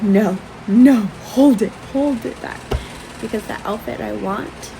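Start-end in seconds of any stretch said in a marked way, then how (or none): 0.0-2.6 pleading shout. Retold or imitated. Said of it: "I'm like".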